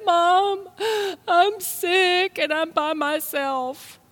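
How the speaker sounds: high, slow, tearful mock sob; retold crying plea. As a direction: replay the young self crying to mom on the phone. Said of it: "I started crying".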